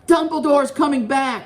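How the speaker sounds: very high, excited cheer; joyful yell. Acted. Several people at breakfast hoping for good news, joyful yell. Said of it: "several people yelled joyfully".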